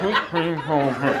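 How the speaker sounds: indistinct mumble to oneself; half-mouth the order under your breath; mumbled self-rehearsal. Retold or imitated. Customer silently practicing his order in line, indistinct mumble.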